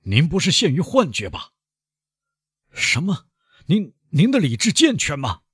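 high, shocked outcry; loud exclamation. A narrator's reading. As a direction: cry out his name in disbelief. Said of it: "庭长大声地说".

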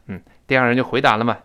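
usual speaking voice

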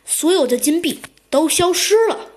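plain reading voice

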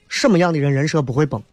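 usual speaking voice

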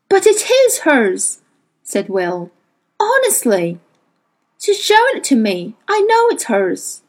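Will defending his friend; earnest insistence. A narrator's reading. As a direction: plead firmly for Lyra. Will insists on Lyra's ownership.

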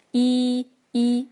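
一 as a long, steady "ee". Shown as a demonstration. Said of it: "长一音要拉长至一到两秒".

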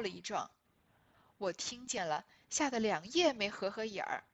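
deliberate, emphatic recital of a character's speech; dramatic classic-novel reading. Acted. Schemer pleading her case to her sister-in-law, dramatic reading. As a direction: read it out firmly, phrase by measured phrase.

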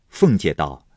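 ordinary delivery, nothing marked

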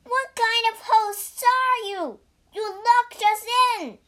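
high, loud, indignant protest; outraged cry. A narrator's reading. Bajie to the hosts who locked him in, outraged cry. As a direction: yell in outrage at being trapped. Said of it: "cried Bajie".

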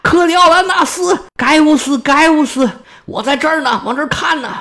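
very high, loud, delighted hailing; old man spotting the general, calling out gleefully with a laugh; overjoyed calling. Acted. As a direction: wave him over with pure joy. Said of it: "高兴坏了".

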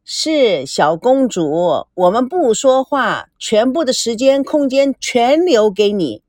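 ordinary delivery

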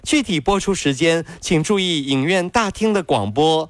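模仿机场播音员一板一眼地致歉; 音调偏高的公式化广播通知腔; 机场延误广播. Acted. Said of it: "就是在高铁，就是在机场". (学高铁、机场广播播报延误)

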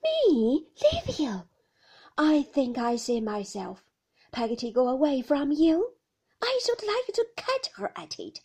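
loud, fond indignation; indignant servant. A narrator's reading. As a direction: scoff at the very idea. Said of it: "went running on in her own fashion".

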